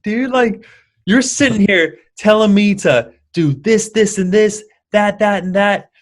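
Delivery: high, loud, incredulous outburst at his teacher; outraged disbelief. Retold or imitated. Dan confronting Socrates over the gin, high and loud outrage. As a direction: throw it at him like you've caught him red-handed. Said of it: "And Dan's like".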